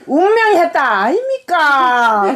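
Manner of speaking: Gyeongsang lilt, slow drawn-out ending; Gyeongsang dialect. Shown as a demonstration. Mother repeats the death notice on request, Gyeongsang dialect. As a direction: stretch the final syllables in Gyeongsang. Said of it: "경상도 뭐라 한다고?".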